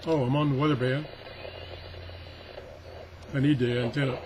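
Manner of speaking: normal talking voice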